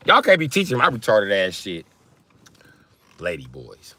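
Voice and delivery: ordinary talk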